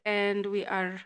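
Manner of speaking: ordinary talk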